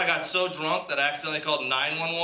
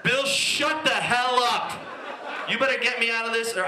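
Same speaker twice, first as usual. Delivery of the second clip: put-on cocky drunk snap; 6 semitones higher than usual. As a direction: cut him off with drunk bravado. Drunk cutting off operator.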